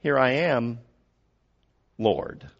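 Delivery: soft, easy answer of a servant; hushed, settled reply to God; calm reply. Acted. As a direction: answer quietly, untroubled. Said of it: "And so he said".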